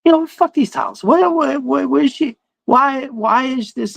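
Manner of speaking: high, worked-up indignation; indignant rant. Retold or imitated. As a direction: rant about the silly towels. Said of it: "And I was like".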